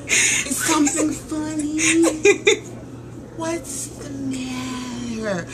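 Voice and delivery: soft, drawn-out prim questions; slow, proper imitation. Mimicking dad's wife asking what's the matter, slow and proper. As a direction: draw each word out, mock-proper. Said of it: "She just talks so slow".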